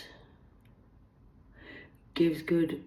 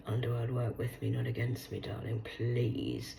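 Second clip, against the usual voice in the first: ordinary delivery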